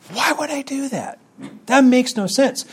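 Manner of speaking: high-pitched incredulous questioning. Real, not acted. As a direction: pitch it way up in disbelief. Incredulous rhetorical question about praying.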